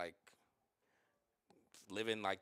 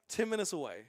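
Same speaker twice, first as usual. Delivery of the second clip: ordinary talk, nothing marked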